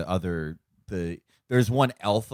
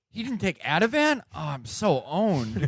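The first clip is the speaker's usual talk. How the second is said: gasping, high, hammed-up dismay; mock-shocked prosecutor; 7 semitones higher than usual. Podcaster imagining an owned federal prosecutor, mock-shocked. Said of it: "imagine a federal prosecutor going like".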